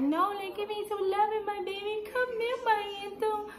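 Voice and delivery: high-pitched cooing for affection; doting mom voice. Acted. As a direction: coo to your little boy in a high voice. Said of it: "But at the same time, I'm like".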